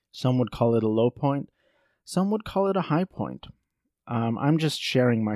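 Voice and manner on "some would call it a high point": higher-pitched, lighter contrasting line; pitched up high, ending in a fall; raised pitch. Shown as a demonstration. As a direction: lift the pitch through "high point". High point set against low point.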